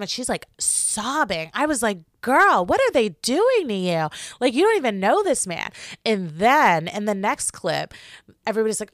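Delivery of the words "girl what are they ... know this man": big-sister exasperation at the screen; incredulous sass. Real, not acted. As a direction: talk to the TV, half-teasing, half-exasperated. Reacting to sobbing contestant.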